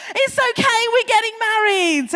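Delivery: high, loud acted outburst; mock blurted announcement. Margaret announcing a sham engagement to her assistant, high and loud. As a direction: announce it at full volume, hammed-up.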